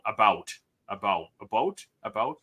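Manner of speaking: Canadian "about" with shifted vowel; mother-in-law's about. Retold or imitated. Imitating mother-in-law, Canadian accent on about. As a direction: try "about" several ways toward "a-boat". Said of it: "even my mother-in-law, she'll, she'll say about".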